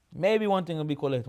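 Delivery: normal talking voice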